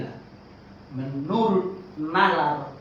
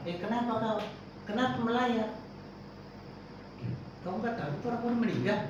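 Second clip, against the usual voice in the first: mourner asking; 7 semitones higher, 7 dB quieter, faster than usual. Pretend. Mourner voiced in example.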